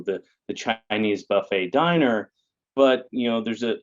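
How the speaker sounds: ordinary talk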